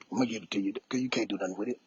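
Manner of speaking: fast, soft confiding murmur; hushed aside. Real, not acted. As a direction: slip it to them quietly and quick. Confiding secret quickly, hushed.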